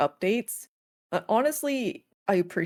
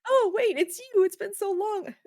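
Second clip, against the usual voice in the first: high, amazed recognition; surprised villager voice. Retold or imitated. Villager recognising the returning player, high and surprised. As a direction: act the villager suddenly recognising you. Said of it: "they're like".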